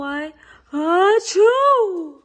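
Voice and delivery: sneeze. Acted. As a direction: let the sneeze build then burst. Sneezing fit.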